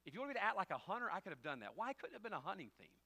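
loud, high exasperated pleading; protest, half laughing at himself; comic protest. Real, not acted. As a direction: pastor begging not to play the sheriff. Pastor's comic protest about sheriff role.

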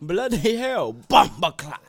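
loud mock Australian-British "bloody hell"; jokey Australian-British exclamation. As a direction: blurt it in a hammed-up Australian-British accent. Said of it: "Fuck little Australian".

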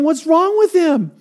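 high, slow puzzled wife voice. Retold or imitated. Wife seeing husband in a jacket, high puzzled voice. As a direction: wonder what's wrong with him.

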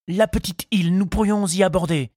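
high, eager suitor's voice; excited exclamation. A narrator's reading. Charles suggests landing on the island, excited. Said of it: "s'écria-t-il".

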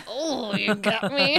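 slow goofy cartoon slacker; drawn-out lazy cartoon hippie; Shaggy voice. You sound like S-, Shaggy.

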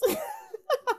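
a bright, high-pitched laugh. Real, not acted. Host laughs at her own movie complaint.

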